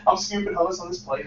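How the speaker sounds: normal talking voice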